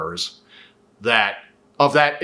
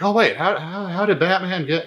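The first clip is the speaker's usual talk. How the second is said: higher, puzzled double-take; mock confused midnight realization; sudden realization; 6 semitones higher than usual. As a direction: catch the plot hole mid-thought. Realizing a plot hole at fridge.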